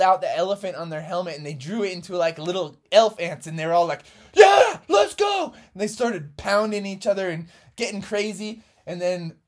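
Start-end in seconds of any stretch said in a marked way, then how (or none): 4.3-5.5 pumped up shout. Acted. Team pumped up.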